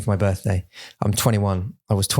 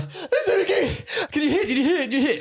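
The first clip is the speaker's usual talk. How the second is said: mock panic. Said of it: "you're like".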